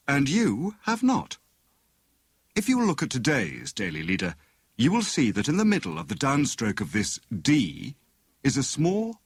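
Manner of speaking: mock-grand lecture to his wife; Tommy explaining. A narrator's reading. Tommy explains newspaper dots.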